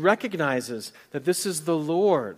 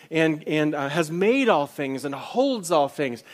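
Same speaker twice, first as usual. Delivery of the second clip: rising, louder sermon voice, building on each line; preaching at full force; 3 semitones higher, 6 dB louder than usual. Real, not acted. Preacher exalting the Almighty to his congregation, with rising fervour. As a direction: proclaim who God is to the congregation.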